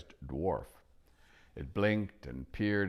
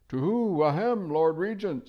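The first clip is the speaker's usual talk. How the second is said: high, louder hooting owl; 12 semitones higher, 8 dB louder than usual. Acted. Owl politely hooting to the deaf dwarf. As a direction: hoot up at a deaf old dwarf.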